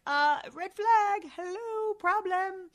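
high, slow, hammed-up alarm; mock alarmed expert. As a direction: squeal it like a panicked expert. Mocking public health experts.

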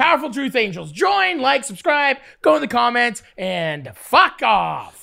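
punchy plug with a long drawn-out ending; rally the fans, then stretch the dismissal; mock sign-off.

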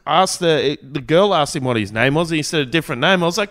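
ordinary talk, nothing marked

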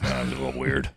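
very low, as if off the record; low confidential voice. Real, not acted. Interviewer remarking on own odd voice, low confidential voice. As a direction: keep it down at the bottom of the voice. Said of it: "I don't know why I'm talking like this".

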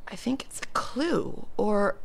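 hushed, slow whisper. Acted. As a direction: breathe it like a secret. Mysterious clue found.

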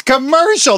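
commercial said high and slow as kuh-MER-shul; corrected pronunciation. Shown as a demonstration. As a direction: slowly correct commercial, no "a" at the start. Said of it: "There's no A's in the beginning".

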